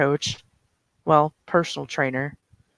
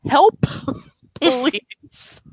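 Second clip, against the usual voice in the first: pleading for help; 8 semitones higher than usual. Acted. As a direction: beg him to step in, half-laughing. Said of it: "Claire looks to Alexei like".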